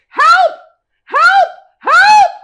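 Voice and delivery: very high, loud screams for help; screaming for help. Acted. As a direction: shriek for help across the house. Wife calls out falsely.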